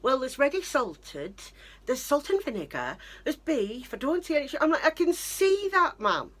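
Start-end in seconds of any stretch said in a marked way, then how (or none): 0.0-4.5 high fussy mum voice, searching shelves; imitating mum. Mum bending over the crisp shelf, mimicked high and fussy. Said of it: "she's going". 4.9-6.3 sarcastic retort. Retold or imitated. Snapping back at mum.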